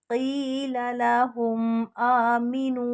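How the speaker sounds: आमिनू with the final ऊ drawn long; long vowels. Shown as a demonstration. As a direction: stretch out आमिनू slowly. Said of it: "उसको भी लंबा करके पढ़ते हैं". (आमिनू को लंबा करके)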